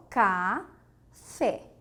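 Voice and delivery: "café" very slow, "ca", pause, "fé"; two separate moments. Shown as a demonstration. Teacher proving café has two syllables, spaced out slowly. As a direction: stretch "ca", wait, then drop "fé". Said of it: "são dois momentos para você proferir".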